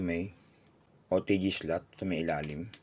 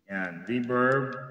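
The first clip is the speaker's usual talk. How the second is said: reverb; much slower than usual. Shown as a demonstration. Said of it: "ito naman po yung volume ng ating reverb".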